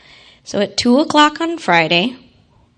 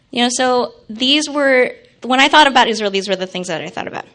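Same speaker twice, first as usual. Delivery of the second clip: ordinary talk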